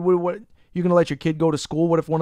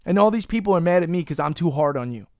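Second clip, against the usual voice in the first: normal talking voice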